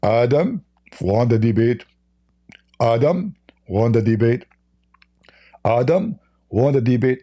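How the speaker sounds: hammed-up solemn recital; mock quoting Muhammad. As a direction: drone it out like a sermon. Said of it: "He said".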